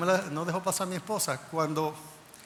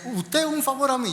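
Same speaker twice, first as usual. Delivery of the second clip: high, louder incredulous question; taken aback; 9 semitones higher, 5 dB louder than usual. Retold or imitated. Consul astonished that an applicant asks a favour, taken aback. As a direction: imitate an official astonished by the request.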